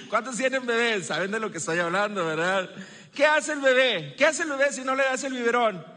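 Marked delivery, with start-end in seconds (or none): none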